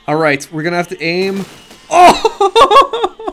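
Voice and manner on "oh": high, loud yelp into laughter; shocked laugh. Real, not acted. Mammoth flung off, laughing.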